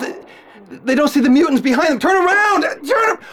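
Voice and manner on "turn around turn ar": high, urgent yell; warning shout. Acted. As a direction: scream a warning at the firemen. Reporter yells warning at firemen.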